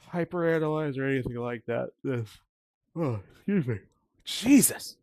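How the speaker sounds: talking through a yawn; words stretched by a big yawn. Real, not acted. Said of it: "I keep yawning in y'all ear".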